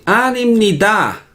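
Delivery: mock Italian accent, high and slow on "animnida". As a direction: imitate Italians singing out "animnida", syllables separated. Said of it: "cuando hablan en coreano, pronuncian así". (acento italiano imitado, agudo y cantado)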